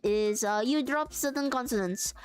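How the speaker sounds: put-on French accent, halting as it explains; hammed-up French, dropped H's, searching for words. Shown as a demonstration. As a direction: teach in a simple stage French accent. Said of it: "That is how you do a, a very simple French accent".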